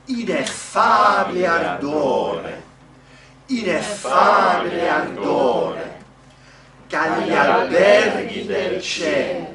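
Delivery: slow, booming Italian recitation; loud declaimed model. Shown as a demonstration. Coach giving singers the Italian to repeat, loud and declaimed. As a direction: project each phrase, stretching the stressed vowels.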